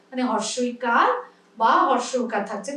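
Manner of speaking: usual speaking voice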